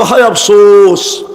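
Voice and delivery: a hammed-up lament from the damned; retold wail of regret. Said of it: "ওই দিন ওরা চিৎকার দিবে". (কাফেরদের আফসোসের চিৎকারের হালকা অনুকরণ, ধীরে ও জোরে)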